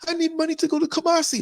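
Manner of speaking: high mock-pleading woman asking for money; begging woman. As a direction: mimic her plea for money in a high, needy voice. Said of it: "And she said".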